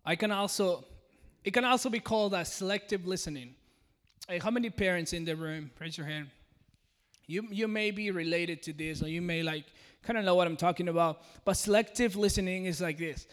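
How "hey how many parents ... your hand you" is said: usual speaking voice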